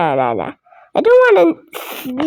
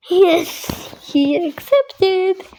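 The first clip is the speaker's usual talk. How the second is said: ordinary delivery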